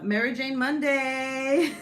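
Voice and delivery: loud, drawn-out title call; playful announcer. Pretend. Host announcing the show to viewers, playful and loud.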